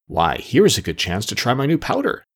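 ordinary delivery, nothing marked

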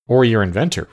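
plain reading voice

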